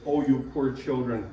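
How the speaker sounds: soft, pitying coo of a coddling God; mock tender God. Coddling God soothing whiny children, mock soft and pitying. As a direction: hush them like poor children.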